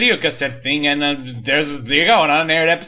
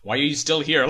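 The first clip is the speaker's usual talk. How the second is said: usual speaking voice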